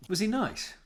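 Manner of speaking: high, eager asking; excited question. Real, not acted.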